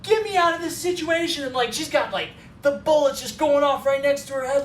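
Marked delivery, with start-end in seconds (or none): 0.0-1.4 higher-pitched frantic demand; hammed-up distress without real sobs; mock crying plea. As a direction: imitate a tearful passenger begging to escape. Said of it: "starts crying".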